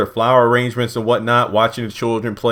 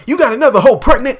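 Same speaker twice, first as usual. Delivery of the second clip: much higher, louder and fast, mock outrage; mock outraged wife; 13 semitones higher, 8 dB louder, faster than usual. Wife learning about the other pregnancy, mock outraged shriek. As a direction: fire it off like a furious wife. Imagined wife outburst over Tally.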